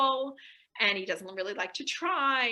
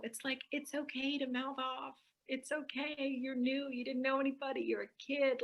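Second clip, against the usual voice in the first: gentle reassurance; 2 semitones higher, 7 dB quieter than usual. Acted. Comforting the child in memory.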